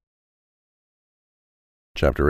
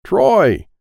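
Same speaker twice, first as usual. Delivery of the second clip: loud call; 11 semitones higher, 7 dB louder than usual. Acted. Abe calls across the room.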